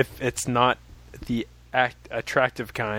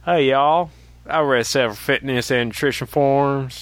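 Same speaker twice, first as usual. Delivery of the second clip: put-on southern accent; 3 semitones higher than usual. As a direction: greet them like a Texas ranch hand. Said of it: "should I do this in a southern accent".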